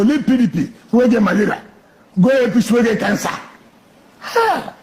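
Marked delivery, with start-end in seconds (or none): 4.2-4.7 scornful ha. Real, not acted. Scornful exclamation.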